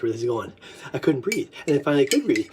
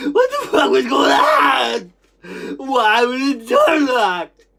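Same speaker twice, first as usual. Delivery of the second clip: crying out; 3 semitones higher, slower than usual. Retold or imitated. Reliving being locked in, crying out. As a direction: wail it slowly through tears. Reliving a frightening memory, cries out.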